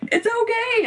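high, sing-song it's okay; high sweet reassurance to a tense husband; soothing. Retold or imitated. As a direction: croon it to calm him. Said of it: "just go".

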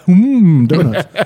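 lightly voiced cartoon dad drooling; a hint of Homer craving donuts; Homer Simpson voice. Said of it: "Also, Homer Simpson".

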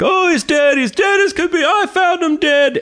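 very high, strained imitated scream; screaming deaf neighbor. Host as deaf neighbor in ice cream shop, high screamed announcement. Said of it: "he just screams from all that tractor work".

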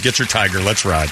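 ordinary talk

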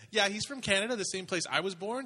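put-on a boy proudly explaining his uncle; proud kid. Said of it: "He's like".

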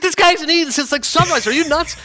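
high-pitched indignant scolding; retold unloading. Friend defending a fasting colleague, retold unloading. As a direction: scold him in disbelief, voice way up. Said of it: "Anish just unloads".

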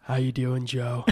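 lightly done Steven Seagal growl; a hint of a tough action star; Steven Seagal impression. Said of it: "first time I've ever done that impression".

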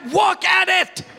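put-on high, loud, slow toddler wailing wook at it; upset toddler. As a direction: cry it out like an alarmed two-year-old. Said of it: "he is upset".

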